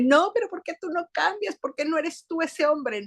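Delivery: high, pleading plea to her husband. Retold or imitated. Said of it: "Y yo suplicándole".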